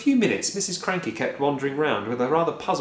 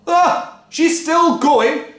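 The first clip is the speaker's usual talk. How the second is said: high, loud, slow gloat; gleeful shout; 10 dB louder, much slower than usual. A narrator's reading. Father gleefully shouting as Grandma shrinks. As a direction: shout with glee. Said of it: "shouted Mister Cranky gleefully".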